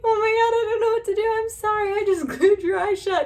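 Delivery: high, loud panicked squeal; retold panic. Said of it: "And I was like".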